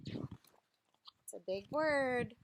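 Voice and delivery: slow, lively picture-book reading voice; reading to children. Acted. Reading a tree picture book to children, lively storytime voice. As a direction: read it out like a storytime for little kids.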